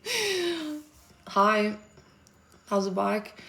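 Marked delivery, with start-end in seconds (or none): 0.1-0.8 "either" said high through a laugh. Real, not acted. Streamer laughing at her own Zulu: high laughing. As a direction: laugh at the failed attempt.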